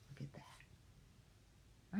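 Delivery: slow breathy whisper aside. Real, not acted. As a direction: whisper it in awe. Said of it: "aside to kids, whisper".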